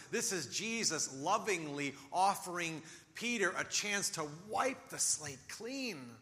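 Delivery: ordinary talk, nothing marked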